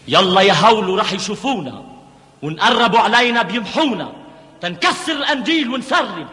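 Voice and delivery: high, loud, pressing call; urgent urging. Acted. Urging Houlo before they are seen, urgent.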